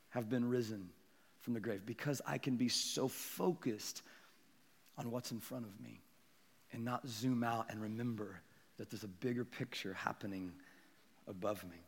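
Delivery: low, very soft preaching; quiet, reflective pulpit confession with pauses; hushed reflection. Real, not acted. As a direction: let it fade almost to nothing.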